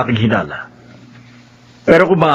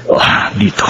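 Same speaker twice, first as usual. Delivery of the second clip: whisper; 2 semitones lower than usual. Acted. Narrator sneaking into hiding.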